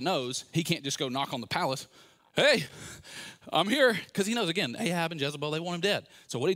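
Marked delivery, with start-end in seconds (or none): 2.4-4.0 loud, higher calling out at the door; hammed-up announcing at the door; mock call. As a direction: shout it like knocking at the palace. Elijah imagined knocking at palace.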